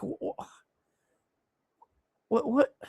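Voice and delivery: high, small stammered what; speechless, squeaky confusion; baffled disbelief. Retold or imitated. Acting out baffled reaction.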